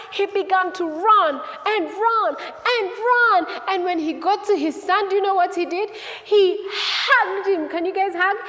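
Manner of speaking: put-on high, loud, rousing storytelling to children; animated kids' storyteller at full energy; excited story narration. A narrator's reading. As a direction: build the excitement of running to a hug.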